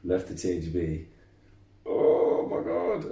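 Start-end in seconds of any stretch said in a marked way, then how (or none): 1.8-3.1 slow, much higher "oh my God" in a changed voice; exaggerated horror, stretched out; mock dismay. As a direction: groan it in disbelief at your own mistake. Realising what they had done.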